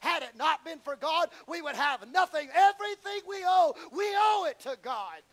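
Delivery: loud rising preacher's cry; full-force preaching. Acted. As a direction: preach it out with power.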